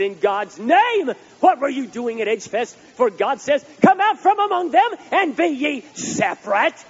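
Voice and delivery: loud, high hellfire preacher, slow at first; mock angry preacher. Pastor quoting a scathing email, loud and mock-stern. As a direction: ham up a scolding fire-and-brimstone pastor. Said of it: "that's how them preachers say it".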